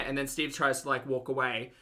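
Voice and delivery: ordinary delivery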